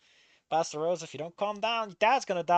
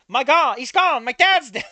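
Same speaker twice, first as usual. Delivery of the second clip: higher, louder hammed-up grief; melodramatic wail; 6 semitones higher, 6 dB louder than usual. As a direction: overplay the shock of losing dad. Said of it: "This is such a bad line".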